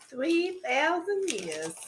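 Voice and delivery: slow drawn-out. Acted. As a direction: drag it out playfully. Joking exaggeration, slow.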